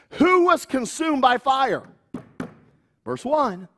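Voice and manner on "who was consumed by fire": ordinary delivery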